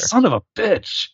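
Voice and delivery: high, hammed-up indignant curse; mock outrage. Cohost teases the name slip with mock outrage. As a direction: play it as fake fury over a tiny mistake.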